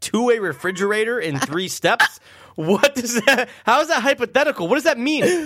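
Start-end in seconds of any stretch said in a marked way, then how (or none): 2.5-5.4 high-pitched baffled disbelief; baffled high outburst. Real, not acted. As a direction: squeak out the confusion. Confused outburst at question.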